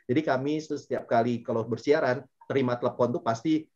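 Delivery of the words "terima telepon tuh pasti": normal talking voice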